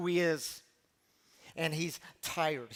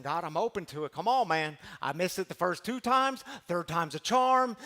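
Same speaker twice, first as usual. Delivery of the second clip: louder, coaxing call to God; eager pleading; 6 dB louder than usual. Retold or imitated. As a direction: urge God on, half joking. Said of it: "I imagine Elijah standing there".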